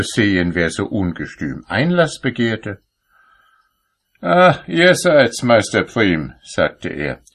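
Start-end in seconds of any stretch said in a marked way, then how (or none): 4.2-6.3 Saint Peter. A narrator's reading. Saint Peter recognises the cobbler.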